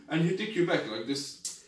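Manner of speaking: usual speaking voice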